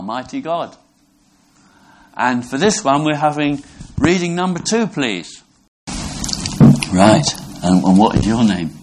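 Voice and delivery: usual speaking voice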